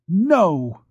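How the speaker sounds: high, forceful No; emphatic no. Acted. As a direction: throw the No back at him. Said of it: "She replied with emphasis".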